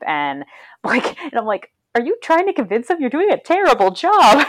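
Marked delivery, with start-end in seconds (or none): 1.9-4.5 high-pitched, incredulous questioning; mock incredulity. Viewer questioning the woman's clumsy threat, mock incredulous. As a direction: question it in exaggerated disbelief. Imagined baffled reply to odd threat.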